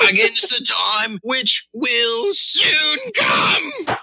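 high, drawn-out pirate innuendo; lewdly hint it as a hammy pirate; horny pirate. Acted. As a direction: suggestive pirate declamation. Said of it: "heavily innuendoed".

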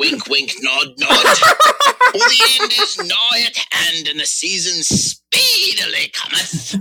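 high, drawn-out pirate innuendo; lewdly hint it as a hammy pirate; horny pirate. Acted. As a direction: suggestive pirate declamation. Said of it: "horny pirate".

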